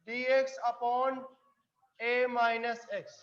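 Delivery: drawn-out terms, evenly pitched; slow dictation. Real, not acted. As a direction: say each term slowly as it gets written. Teacher spelling out formula for board. (धीरे-धीरे, एक-एक पद बोलते हुए)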